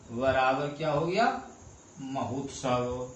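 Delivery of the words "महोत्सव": महोत्सव spoken slowly and deliberately for the class, ओ held clearly; slow demo word. Shown as a demonstration. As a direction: spell out महोत्सव slowly so students hear the ओ. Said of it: "तो यहाँ का देखना". (महोत्सव धीरे और साफ़ बोलकर)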